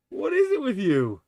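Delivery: high pet-talk voice; sing-song scold for the kitty; talking to a cat. Real, not acted. Teasing the cat.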